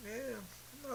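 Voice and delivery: squeaky high-pitched yeah; mock high yeah. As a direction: toss off a high, airy yeah.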